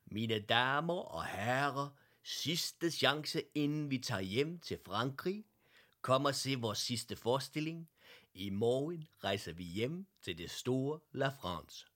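loud, high circus barker calling out; funny announcer voice. Acted. Circus announcer calls passers-by into the tent, loud barker voice. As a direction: drum up a crowd for the last show. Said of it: "en sjov stemme".

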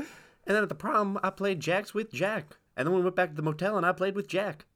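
grandma voice. Pretend. Grandchild joins grandma's story.

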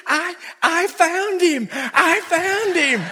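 high, slow, awed lady's voice; drawn-out, high-pitched mock amazement; starstruck lady. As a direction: imitate a stunned fan claiming her discovery. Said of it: "that lady that had screamed at him, she was just standing there saying".

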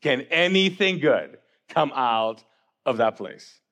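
long low drawl, dripping with doubt; sarcastic. Acted. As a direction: drag it out slowly, spunky and unimpressed. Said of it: "they're sarcastic. They're like".

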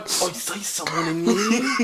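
put-on slow, giggly British-or-Aussie accent; badly faked British, sliding toward Crocodile Dundee. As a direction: draw it out in a muddled fake British accent. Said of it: "going British or fucking crocodile".

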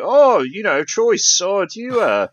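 retelling a tour guide's high-pitched chatty question. Retold or imitated. Tour guide sounding out a tourist in a horror T-shirt, high imitation. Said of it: "the tour guide's like".

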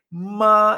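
"ma" held high and level; first tone. Shown as a demonstration. Teacher sums up the first tone, "ma" high and steady. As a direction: keep "ma" on one high, unbending note. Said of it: "es el primer tono".

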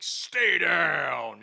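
loud, drawn-out mock command; imitated shouted order. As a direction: act out a comic-book hero yelling an order. Cable's shouted order, acted.

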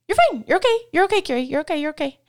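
high, loud, hurried there-there reassurance; brushing-off reassurance. Retold or imitated. Jesus does not brush off a worried Carrie like this, high dismissive chirping. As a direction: chirp it over and over to wave the feeling away. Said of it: "he doesn't say".

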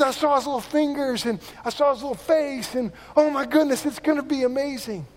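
high-pitched retold wonder; thrilled father-to-be. Voices friend marvelling at his baby.

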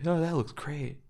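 flat, quiet deadpan delivery. Retold or imitated. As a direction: say it with zero enthusiasm. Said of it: "In his deadpan voice".

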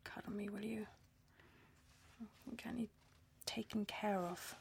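soft pleading whisper. Real, not acted. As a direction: whisper it close and needy. Asks husband for comfort.

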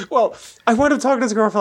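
laughing through the words, high and fast. Real, not acted. Teased by his friend about Gatsby, talking through laughter. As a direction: keep laughing as you restart the story.

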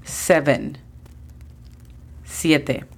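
ordinary delivery

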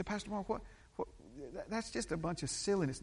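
objecting listener. Acted. Church member objecting to Pastor Mark, mock protest. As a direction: lightly voice a baffled doubter. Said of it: "You say".

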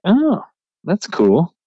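light up at the discovery; delighted little oh; pleased surprise. Retold or imitated. Finds deicer on shelf.

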